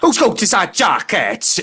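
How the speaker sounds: high, slow, indignant Welsh accent; exaggerated stroppy Welsh impression; Welsh accent with attitude. Shown as a demonstration. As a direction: play up a pissed-off Welsh voice, pitched high. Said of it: "pissed off at the same time".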